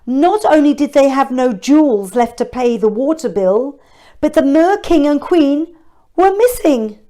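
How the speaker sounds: ordinary delivery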